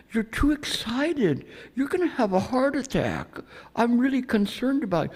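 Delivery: high, loud, over-the-top soothing; mock concern. To a tall man pointing at him, high mock-concerned calming. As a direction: pretend to calm an angry man down, way up high. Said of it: "I went".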